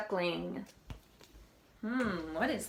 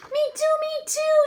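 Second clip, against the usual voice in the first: high, loud little chick; a chick copying the duck, high and loud; 8 dB louder than usual. Acted. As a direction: squeak it out eagerly like a tiny chick. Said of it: "said the chick".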